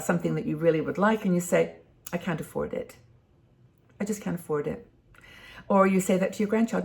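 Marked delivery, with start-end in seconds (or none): 2.1-4.8 soft, deflated can't afford it; a quiet, defeated mumble; mock dejected sigh. Shown as a demonstration. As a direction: say it like you've given up. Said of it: "you say it in a way that then starts to make you feel sad".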